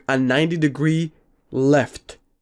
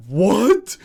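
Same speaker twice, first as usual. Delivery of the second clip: higher, incredulous what; retold surprise; 6 semitones higher, 3 dB louder than usual. Friend told he passed out, retold surprise. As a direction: blurt it out in disbelief.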